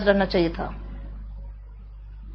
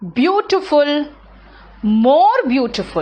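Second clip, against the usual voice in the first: drawn out; 2 semitones higher, much slower than usual. Shown as a demonstration. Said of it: "teacher modelling the comparative form".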